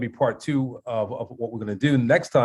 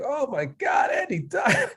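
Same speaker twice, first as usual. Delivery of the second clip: shocked outcry; 10 semitones higher, slower than usual. Retold or imitated. Imitating a brother hearing Andy died, shocked outcry. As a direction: blurt it out like the news just hit. Brother reacting to Andy's death.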